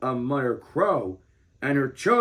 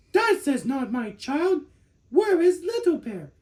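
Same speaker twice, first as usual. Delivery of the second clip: put-on a mother bear who finds the wrong child; startled mother bear; 13 semitones higher than usual. A narrator's reading.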